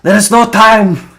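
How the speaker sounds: urgent in-character shout; urgent cry. Acted. Game master's character urges flight.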